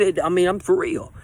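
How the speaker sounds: ordinary talk, nothing marked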